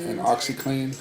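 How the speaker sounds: ordinary talk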